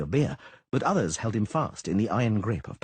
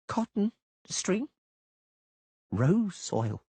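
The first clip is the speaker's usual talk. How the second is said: each word drawn out, slow; enunciated very slowly; 11 semitones higher, much slower than usual. A narrator's reading. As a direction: spell out the disbelief word by word. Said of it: "enunciating each word very slowly".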